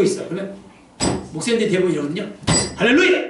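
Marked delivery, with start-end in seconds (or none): 2.8-3.3 loud, high burst of praise; startling wake-up shout. Acted. Pastor waking a dozing congregant, startling shout. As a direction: jolt a sleeper awake. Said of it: "그럼 자다가 막 깜짝 놀라요".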